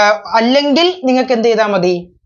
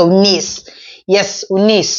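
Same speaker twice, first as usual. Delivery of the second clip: ഉന്നീസ് with the final S drawn out and leaned on; stress on the S; slower than usual. Shown as a demonstration. Teacher showing students how to say ഉന്നീസ്, S stressed. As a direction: land hard on the closing S sound of ഉന്നീസ്. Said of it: "എസിന് stress കൊടുക്കുക".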